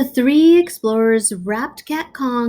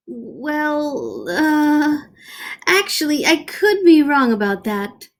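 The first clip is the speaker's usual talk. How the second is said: sheepish backpedal; 6 semitones higher than usual. Acted. Doctor backpedals.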